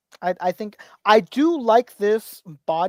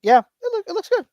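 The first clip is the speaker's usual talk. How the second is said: higher, louder "looks good"; high squeaky approval; 12 semitones higher, 5 dB louder than usual. Acted. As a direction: pitch it way up, playful.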